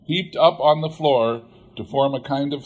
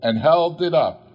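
usual speaking voice

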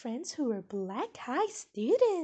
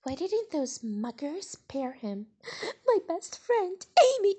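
plain reading voice

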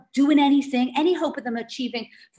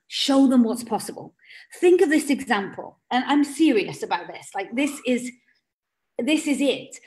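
ordinary talk, nothing marked

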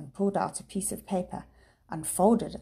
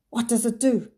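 hoarsely; 5 semitones higher, 7 dB louder than usual. A narrator's reading. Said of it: "he asked hoarsely".

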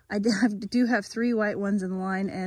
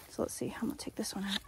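whispering; 2 semitones lower, 13 dB quieter, faster than usual. Real, not acted. Quick whispered aside while working.

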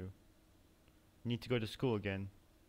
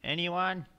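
calling out; 5 semitones higher, 9 dB louder, much slower than usual. Real, not acted. Calling out in empty place.

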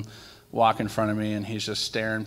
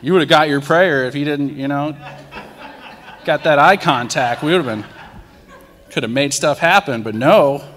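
louder, higher, laughing through it; laughing rant; 5 semitones higher, 12 dB louder than usual. Real, not acted. Man laughing at himself for being mad at the guy. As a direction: laugh at your own grudge out loud.

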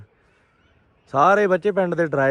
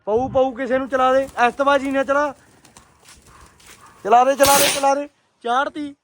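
excited urging; 9 semitones higher than usual. Real, not acted. Urging friend to fire crackers.

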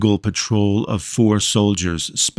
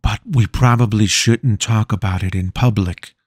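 usual speaking voice

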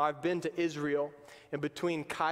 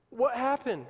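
normal talking voice

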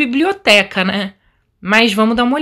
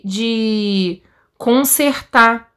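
ordinary talk

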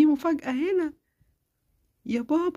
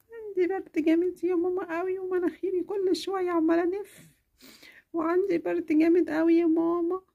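put-on sick little girl. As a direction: feverish child complaining to mama. Child repeats complaint.

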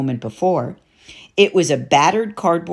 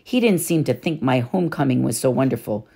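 ordinary talk, nothing marked

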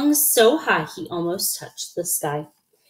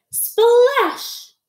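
high-pitched drawn-out "splash"; splash sound effect; 5 dB louder than usual. A narrator's reading. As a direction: voice the splash with a long sh. Story sound word, drawn out.

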